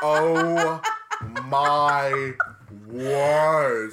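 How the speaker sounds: loud, laughing, drawn out; laughing disbelief. Real, not acted. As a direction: laugh it in delight.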